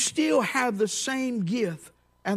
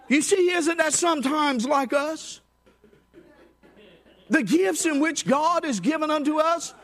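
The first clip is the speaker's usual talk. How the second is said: full-force preaching; 8 semitones higher than usual. Acted. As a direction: drive the point home to the congregation.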